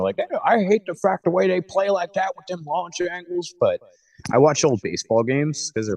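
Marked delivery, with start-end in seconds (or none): 0.2-3.5 higher, hammed-up grumpy old-timer voice. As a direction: gripe like an old baseball fan. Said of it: "I sound like a real old timer".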